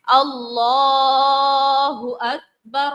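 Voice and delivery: slow recitation of اللہ اکبر with the لام stretched long; drawn-out Allahu Akbar. Shown as a demonstration. Teacher reciting the takbir slowly to students, with the لام lengthened. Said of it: "لام کو کھینچے".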